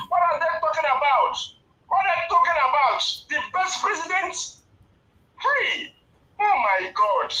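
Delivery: loud, exasperated outburst; real anger. Real, not acted. Caller disputing the best president claim, angry and loud. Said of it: "It made me go angry too much".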